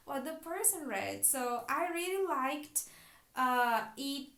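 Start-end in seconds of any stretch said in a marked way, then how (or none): none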